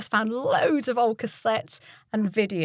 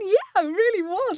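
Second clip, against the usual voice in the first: eager; 10 semitones higher than usual. Retold or imitated. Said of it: "I'm going".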